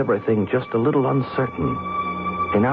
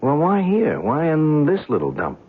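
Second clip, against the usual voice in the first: skeptical challenge. Acted. Skeptic questions his guide about the spot, incredulous. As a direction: press him, doubtful, voice lifted.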